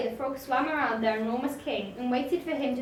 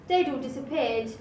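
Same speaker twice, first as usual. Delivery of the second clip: usual speaking voice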